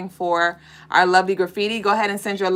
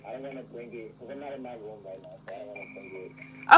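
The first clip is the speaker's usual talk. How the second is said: muffled remote voice; 9 semitones lower, 9 dB quieter than usual. Real, not acted. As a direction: voice muffled by headphones. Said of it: "It's just muffled".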